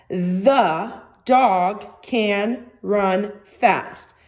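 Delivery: each word spaced out, very slow; very slow dictation. Shown as a demonstration. Teacher to young students, dictating the sentence very slowly. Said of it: "Let's do it again".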